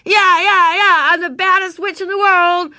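high, very loud whooping boast; rowdy playground holler; wild witch yell. A narrator's reading. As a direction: shriek it like a carried-away child witch. Ramona yelling her witch boast again.

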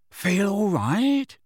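high, slow, kindly question; kind, lilting concern. A narrator's reading. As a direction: check gently on someone, drawing it out. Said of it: "said George kindly".

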